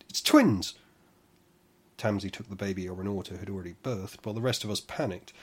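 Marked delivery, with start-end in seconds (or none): 0.1-0.8 loud, much higher alarmed shout. Acted. Nalsu at the birth announcing a second baby, loud shout. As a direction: call it out in shock. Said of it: "she shouted".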